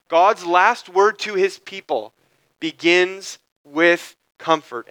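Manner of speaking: slowed right down, each word weighted; slow emphatic restatement. Shown as a demonstration. Preacher repeating the main idea to the congregation, slow and emphatic. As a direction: drive the main idea home slowly. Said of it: "This is the main idea".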